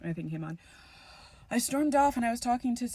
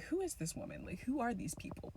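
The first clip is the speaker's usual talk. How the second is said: confused inner voice; 4 semitones higher, 8 dB quieter than usual. Retold or imitated.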